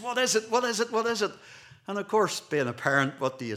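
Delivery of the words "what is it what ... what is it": put-on high, loud, eager child's pestering; excited child. Child begging parent to reveal the birthday surprise, excited. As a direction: pester like an excited kid, pitched right up. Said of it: "gets all excited and says".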